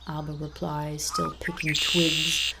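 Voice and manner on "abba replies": ordinary delivery, nothing marked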